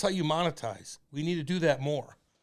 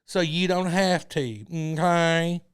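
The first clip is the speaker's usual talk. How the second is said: muffled wah wah talk; 4 semitones higher, 9 dB louder than usual. Retold or imitated. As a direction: drone like the South Park teacher. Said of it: "sounds like the teacher from South Park".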